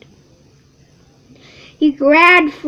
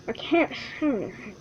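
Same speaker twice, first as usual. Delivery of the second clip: squeezed whisper; 10 dB quieter, faster than usual. Acted. Squirrel squashed under paw.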